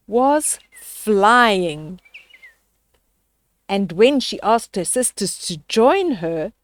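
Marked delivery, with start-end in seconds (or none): none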